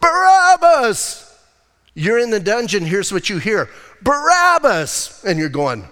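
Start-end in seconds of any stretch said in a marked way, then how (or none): 0.0-1.2 loud, high, drawn-out shout; full-voiced, slow chant of a crowd; crowd yell. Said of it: "And the crowd yells". 4.0-5.2 high, loud, drawn-out call; crowd yell. Acted. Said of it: "And the crowd yells".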